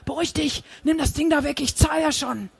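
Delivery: scared victim giving in; frightened pleading. Acted. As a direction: plead for your life, voice up high. Man with knife at throat.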